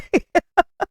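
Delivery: squealing laugh; a high burst of laughter. Real, not acted. High laugh after joke.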